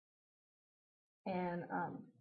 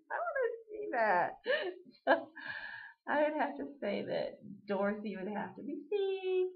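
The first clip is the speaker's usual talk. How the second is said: laughing; 7 semitones higher, 7 dB louder than usual. Real, not acted. Laughing at the thought of Steve.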